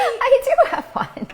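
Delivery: laughing protest. Real, not acted. Protesting she has one.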